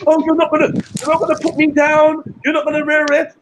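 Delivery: high, loud whiny mimic of Betty; high mock whine of the woman they are mocking. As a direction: squeal it like a spoilt woman complaining.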